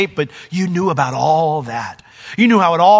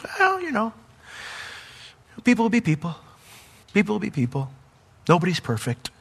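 high, soft, careless shrug of a voice; shrugging indifferent God; 5 semitones higher, 6 dB quieter than usual. Retold or imitated. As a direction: imitate a God who couldn't care less. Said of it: "and God just goes".

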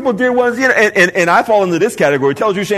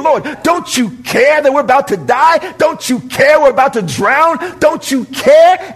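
panicked plea; 7 semitones higher, 3 dB louder than usual. Acted. Panicking disciples in the storm.

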